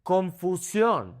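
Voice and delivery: Confusión as kon-foo-SYON; slow Spanish "Confusión", stressed last syllable; Spanish pronunciation. Shown as a demonstration. Teacher models the word, slow Spanish Confusión. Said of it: "focusing on the vowel sounds".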